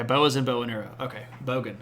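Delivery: ordinary delivery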